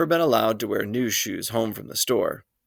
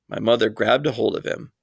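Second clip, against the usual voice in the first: usual speaking voice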